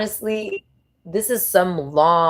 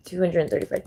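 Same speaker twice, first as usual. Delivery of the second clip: normal talking voice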